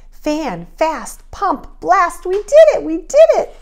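building to high, loud cheer; triumphant cheer. A narrator's reading. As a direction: climb from a chant into a victory cry. Bees cheer success.

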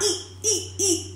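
high, short "ee" winces of pain; imitated pained yelps. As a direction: mimic someone wincing with every step. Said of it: "he in pain".

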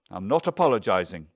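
loud, quick rebuttal; heated, hurried defiance; defiant refusal. A narrator's reading. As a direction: refuse flat out, fast and loud. Husband refuses in quarrel.

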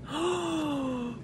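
high soft gasp of delight. Real, not acted. Vlogger delighted by warm February day, soft gasp. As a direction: catch your breath in joy. Stepping out into nice weather.

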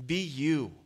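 ordinary talk

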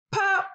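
very high, sharp pop; pop sound. A narrator's reading. Narrator imitating the last button popping, high pop.

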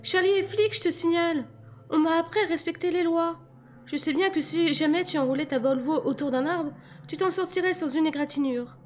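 high-pitched female character, indignant; anxious high-voiced reproach; frightened, scolding young woman. A narrator's reading. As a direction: lecture the driver in her high voice. Voices Bella scolding the driver.

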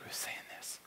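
barely audible, hushed query; whispered question. Acted. Man questioning the voice in his head, whispered. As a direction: whisper it so nobody else hears. Secret reply to inner voice.

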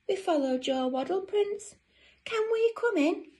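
high, pleading storybook voices; eager penguin sisters. Acted. Sisters at the wooden door, high eager pleading. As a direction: ask sweetly, pitched well up.

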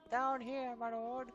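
high, lightly voiced servant; bow and scrape, high-pitched. Servile address.

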